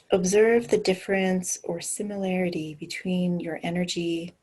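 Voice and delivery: guided meditation. Acted. Leads a grounding meditation.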